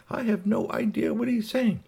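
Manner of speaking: very high-pitched device voice; talking translator gadget. Acted. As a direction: speak as a baffled little translating machine, high up. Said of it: "communicabobulator was sitting there saying".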